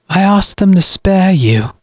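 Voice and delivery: high-pitched, self-righteous villain; cold brother. Acted. Brother tells sister he meant to spare her, cold. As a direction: claim mercy with cold conviction.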